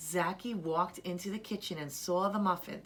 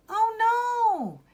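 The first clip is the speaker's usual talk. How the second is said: put-on much higher, loud child's cry of dismay; Zaki's child voice; 9 dB louder than usual. Boy waking late cries out in a high child voice. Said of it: "he said aloud".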